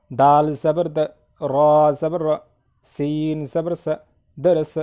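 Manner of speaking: measured, drawn-out letter names, short syllables; spelling drill recitation. Shown as a demonstration. Teacher leading learners through zabar, drill recitation. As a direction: recite letter by letter in a steady rhythm.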